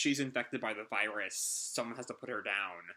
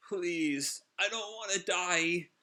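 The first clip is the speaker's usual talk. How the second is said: raised high, begging for his life; high pleading voice; 7 semitones higher, slower than usual. Acted. Dying villain begs Hermit to spare him, high and pleading. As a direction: plead weakly, a dying man.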